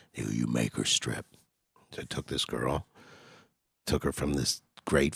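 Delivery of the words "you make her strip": low, gruff pimp impression; lightly roughen it like an old hustler. Pimp friend giving the answer, gruff. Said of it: "real gruff voice, he talk like this".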